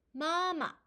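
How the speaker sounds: 마마 with a high held first syllable, short drop on the second; high level 마, then brief falling 마; first tone plus neutral tone. Shown as a demonstration. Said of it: "일 성 뒤에 경성에 왔을 때의 소리".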